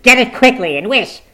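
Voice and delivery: loud, high command turning to anguished wail; desperate mother's cry; frantic pleading. A narrator's reading. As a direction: urge him, then wail for your son. Frantic mother begs for wish.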